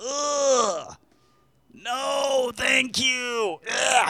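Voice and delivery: high, slow, squeamish whine; mock disgust. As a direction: recoil in hammed-up revulsion. Crowd mocking the idea of mothers.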